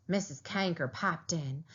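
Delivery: ordinary delivery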